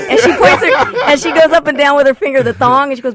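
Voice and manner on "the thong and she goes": usual speaking voice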